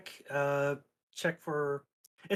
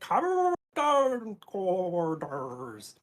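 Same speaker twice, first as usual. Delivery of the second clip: slow, gargled through water; while gargling; 4 semitones higher, 4 dB louder, much slower than usual. Shown as a demonstration. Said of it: "Just be like".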